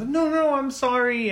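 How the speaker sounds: high, urgent apologetic plea; acted panicky apology; frantic apology. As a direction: plead to be forgiven, pitch leaping up. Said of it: "and apologetic".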